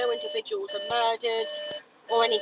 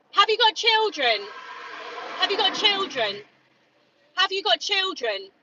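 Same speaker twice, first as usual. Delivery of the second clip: higher and louder, demanding; angry shouting; 10 semitones higher, 5 dB louder than usual. Real, not acted. Activist confronting a meat eater, angry shouting. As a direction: hurl the question at him again and again. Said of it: "I am really angry".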